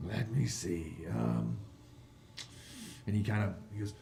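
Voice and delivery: ordinary talk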